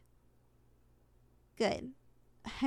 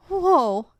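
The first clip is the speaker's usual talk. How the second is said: loud startled whoa; a sudden, loud gasp of amazement; surprised whoa; 3 semitones higher, 11 dB louder than usual. Acted. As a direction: blurt it out in shock. Sees record essence count.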